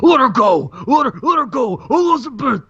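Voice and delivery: hammed-up wrestler yell; desperate shout. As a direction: scream for her to be freed. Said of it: "Savage is like".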